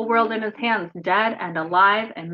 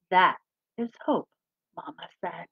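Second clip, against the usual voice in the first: gentle storybook reading; reading to children; 3 semitones higher than usual. Acted. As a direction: read it to the kids like a picture book. Reads picture book aloud.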